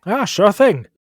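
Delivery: high, loud doctor voice; doctor character. Acted. Doctor agreeing to hire a helper, high and loud. Doctor answers job seeker.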